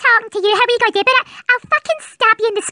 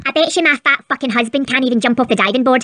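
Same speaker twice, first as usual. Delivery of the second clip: deep, growling Scottish mum; gruff low mother voice; 12 semitones lower than usual. Acted.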